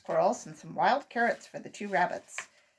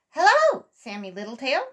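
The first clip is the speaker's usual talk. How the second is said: calling out; 6 semitones higher, 11 dB louder than usual. A narrator's reading. The voice calls to the rabbit boy, calling out. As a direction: hail someone with a big, high hello. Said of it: "called the voice again".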